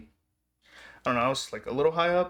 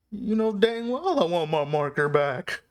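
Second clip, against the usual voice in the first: hammed-up eager reply, higher pitched; over-the-top eagerness; 6 semitones higher, 3 dB louder than usual. Fan answering the player about his marker, hammed-up eagerness. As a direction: push it higher, overdoing the fan's eagerness. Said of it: "that's probably too far".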